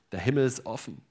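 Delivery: ordinary delivery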